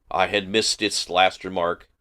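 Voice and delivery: ordinary delivery, nothing marked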